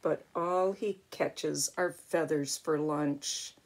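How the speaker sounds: ordinary delivery, nothing marked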